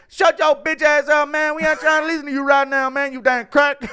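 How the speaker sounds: put-on high, rapid kid yelling back; hammed-up bratty kid cutting dad off; mouthy kids impression. Chandler's kids telling dad to shut up, high mock tantrum. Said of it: "Chandler at home".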